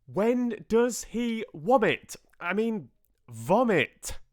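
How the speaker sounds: slow, high, loud bellow; drawn-out high shout; bawling. A narrator's reading. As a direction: bawl each word into a deaf ear. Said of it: "inflated my lungs, and bawled".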